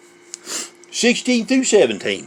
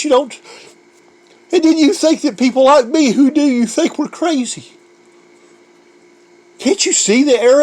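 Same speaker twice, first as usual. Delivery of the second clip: fiery preaching; 5 semitones higher than usual. Acted. Preaches warning at full force.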